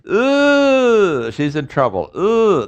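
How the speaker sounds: high sliding "Ooh" like a caught sitcom wife; Lucy in trouble. Retold or imitated. Teacher imitates Lucy caught by Ricky, high mock "Ooh". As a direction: squeal "Ooh" the way Lucy does when she's caught. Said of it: "what does Lucy say?".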